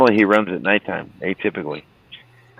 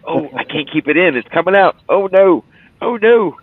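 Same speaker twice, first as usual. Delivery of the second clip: rising to a high, loud mock panic; 10 semitones higher, 4 dB louder than usual. Man about to soil himself, rising mock panic. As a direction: build the panic as he loses control.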